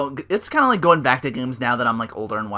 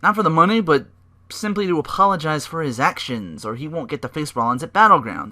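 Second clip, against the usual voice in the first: imitating Heyman; 4 semitones higher, 5 dB louder than usual. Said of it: "Heyman's like".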